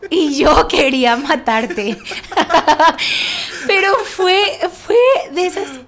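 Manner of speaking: laughing. Real, not acted. Laughing with her husband about the staircase fight, laughing. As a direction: laugh it out while talking. Laughing at wanting to kill him.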